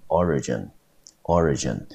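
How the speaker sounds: origin with the stress on OR; teacher's demo of origin, stressed at the start; stress on first syllable. Shown as a demonstration. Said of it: "重音节落在第一个音节".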